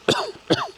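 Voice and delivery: sharp startled cough. Real, not acted. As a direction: hack out a swallowed bug. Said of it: "I might have swallowed a bug".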